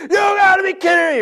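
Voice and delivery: hammed-up exasperated parent; exasperated outburst. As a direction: burst out in disbelief. Parent cuts off excuse.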